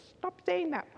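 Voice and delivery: high, firm mock scold from a friend; best friend scolding. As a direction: snap it like a friend fed up with her. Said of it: "My best friend says".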